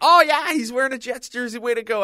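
high, loud excited cheer; mock cheering crowd. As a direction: cheer him on, hammed up. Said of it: "everybody's like".

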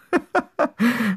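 giggle. Acted. Laughs at the dog.

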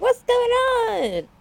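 high drawn-out mock cry of alarm; cartoonishly stretched, high-pitched bewilderment; startled family. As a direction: squeal it in drawn-out mock panic.

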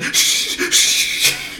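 urgent hushing whisper, half laughing; a mock sister whispering shh; whispered shushing. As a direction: shush him fast so nobody hears. Sisters hushing his screams.

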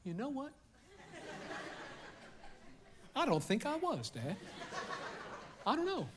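put-on hushed little-kid excuse; sheepish kid. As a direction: answer like a kid caught out. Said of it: "They're like".

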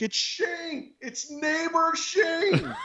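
high-pitched, drawn-out mock scream; retold scream. Neighbor announcing himself to an armed homeowner, high mock scream. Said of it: "But I'm screaming. I'm like".

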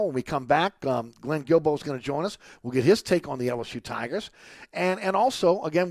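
ordinary talk

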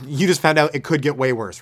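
ordinary talk

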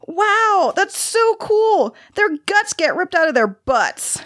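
put-on very high, gleeful child's voice, drawn-out awe; fascinated little boy. A narrator's reading. Boy reading about bee deaths, gleefully fascinated. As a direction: marvel like a kid thrilled by something gross. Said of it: "from skeptical to fascinated".

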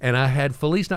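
normal talking voice

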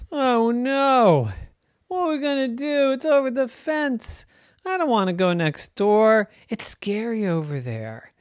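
put-on high, fretful little girl; Jenny worried. Jenny afraid to fetch the ball next door, high and fretful. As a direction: plead like a scared kid. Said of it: "said Jenny".

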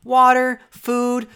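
loud, high, deliberate listing; Niku. Retold or imitated. Co-host quoting Niku listing needs, loud emphatic items. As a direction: punch out each item on its own. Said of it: "And he starts listing".

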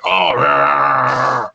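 low growly announcer "Oh, yeah"; growly commentator voice. Retold or imitated. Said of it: "growly commentator voice where it's like".

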